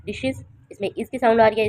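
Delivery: ordinary talk, nothing marked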